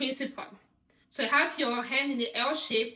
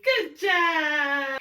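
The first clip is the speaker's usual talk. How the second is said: high, loud cheering praise; excited praise; 5 semitones higher, 4 dB louder, much slower than usual. Real, not acted. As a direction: enthusiastic high five and good job. Praising the child's answer.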